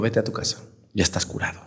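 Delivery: soft Jesus voice. Acted. Jesus gently sends healed man home.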